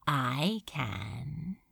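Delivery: quiet malicious gloat; low, soft gloating. A narrator's reading. Beelzebub voiced.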